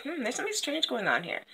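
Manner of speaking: soft, fast, puzzled thinking aloud; Copper Tree. Acted. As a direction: mutter a quick suspicion to yourself. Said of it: "and she's like".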